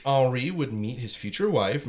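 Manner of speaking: ordinary talk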